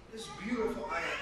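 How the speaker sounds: normal talking voice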